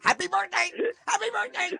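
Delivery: very high sing-song greeting; high street guy voice. Retold or imitated.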